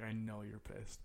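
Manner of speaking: hushed, low acknowledgement, retold; murmured in the ear. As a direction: keep it private, just for one friend's ear. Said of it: "Like, in my ear".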